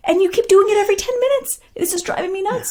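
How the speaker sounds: high-pitched, frantic parental yelling; loud exasperated mom. Acted. Mom snapping back at her kids, hammed-up loud exasperation. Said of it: "You're talking really loud".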